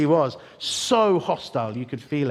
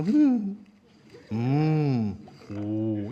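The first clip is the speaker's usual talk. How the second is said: demonstrating non-verbal hums and oohs; wordless humming; much slower than usual. Shown as a demonstration. Coaching his Cockney friend to pass unnoticed, wordless hums. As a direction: communicate in murmured noises only. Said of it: "practice non-verbal communication".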